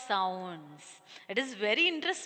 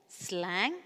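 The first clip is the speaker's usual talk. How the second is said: usual speaking voice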